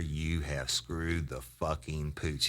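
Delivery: slow, word-by-word acted warning; measured, drawn-out retold reprimand; deliberate confrontation. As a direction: spell it out slowly to a suspect. Officer in suspect's face, deliberate.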